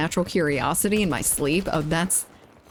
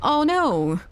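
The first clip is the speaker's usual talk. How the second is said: pitched-up oh no, mock blame; startled oh no; 9 semitones higher than usual. Real, not acted. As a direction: yelp at a teammate's blunder. Game mishap, blaming Felipe.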